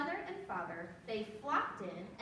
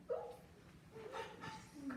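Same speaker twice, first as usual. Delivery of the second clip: soft oops; 10 semitones higher, 11 dB quieter than usual. Shown as a demonstration. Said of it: "Everybody do that".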